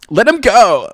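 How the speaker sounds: high, loud Glaswegian plea; Glaswegian dramatic line. Acted. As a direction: belt it like a Scottish film hero. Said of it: "I'm supposed to be Glaswegian".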